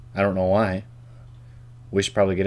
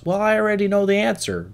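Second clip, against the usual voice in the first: a high, put-on voice answering back smugly; imaginary second voice; 12 semitones higher than usual. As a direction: play the other half of the conversation, pitched up. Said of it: "That's me talking to myself".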